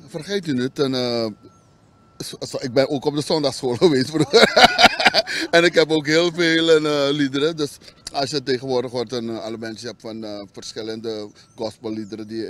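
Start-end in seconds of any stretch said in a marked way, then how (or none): 2.2-7.6 loud, high, amused laughter through the words; real laugh breaking into loud speech. Real, not acted. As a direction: talk through a hearty laugh. Joking about his Sunday school past.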